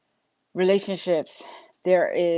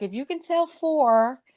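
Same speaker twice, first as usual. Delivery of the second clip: pitching parent; 8 semitones higher than usual. Retold or imitated. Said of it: "And it's like".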